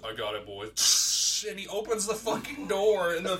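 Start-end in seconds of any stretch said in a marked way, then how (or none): 0.0-1.5 slow, low, cocky. Retold or imitated. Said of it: "Nick just comes on the mic and goes".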